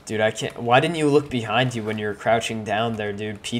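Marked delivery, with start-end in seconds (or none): none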